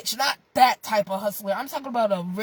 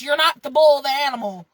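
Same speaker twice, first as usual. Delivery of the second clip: usual speaking voice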